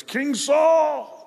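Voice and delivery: loud high shout across a distance; shouting the king's name; calling out. Retold or imitated. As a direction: call out to the king. David calling out from cave.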